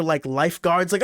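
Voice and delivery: usual speaking voice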